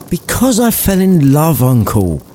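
earnest nephew voice. Acted. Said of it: "nephew pleads".

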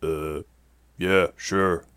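groggy, slightly slurred reply; half-awake man, words running loosely. A narrator's reading. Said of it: "he slurred".

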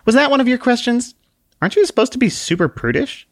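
bristle, then back down; annoyed man. Acted. The man answers Book in annoyance, then hesitates.

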